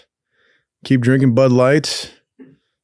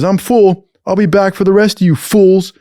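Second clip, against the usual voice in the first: raised, higher cop imitation; cop's announcement; 7 semitones higher, 4 dB louder than usual. Cop to the rest after slamming door, mock commanding call.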